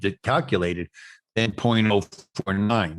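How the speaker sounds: normal talking voice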